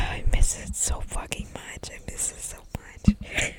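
breathy whispered longing. Real, not acted. Craving coffee while sick, whispered. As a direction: whisper it like a confession of missing something.

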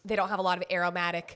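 ordinary delivery

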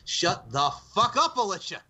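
slow, high-pitched, fed-up tirade; exasperated outburst. Real, not acted. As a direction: drag out each word in exasperation. Exasperated rant about Alicia.

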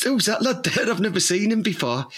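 usual speaking voice

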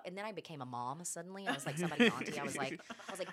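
ordinary talk

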